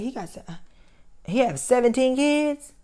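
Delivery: can't believe the number; shocked disbelief. Retold or imitated. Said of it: "I said".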